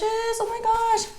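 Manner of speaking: "Oh my gosh" slow and way up high; slow, high, blissful oh my gosh; ecstatic drawn-out exclamation. Real, not acted. Reacting to tasting food.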